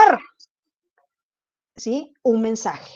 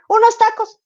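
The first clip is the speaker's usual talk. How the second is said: agudo y rápido; esposo insistente imitado; 10 semitones higher than usual. Retold or imitated. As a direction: insiste animándola. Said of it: "ahorita ya es meme". (esposo ofreciendo hamburguesas, agudo y rápido)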